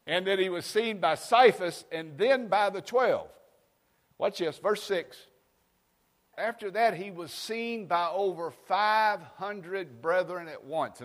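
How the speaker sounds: loud, high, slow preacher's scripture read; fiery pulpit reading; preaching at full force. Acted. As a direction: proclaim each word to the congregation.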